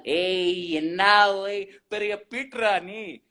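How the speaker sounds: teasing slow Tamil jibe; mock a big shot in Tamil; Bangalore Tamil slang. Guest teases a show-off in Bangalore Tamil. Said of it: "Bangalore Tamil".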